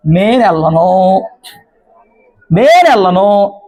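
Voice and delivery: slow, eerie, defiant refusal; possessed spirit voice. Acted. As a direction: drag it out like a stubborn spirit. Spirit in woman refuses pastor.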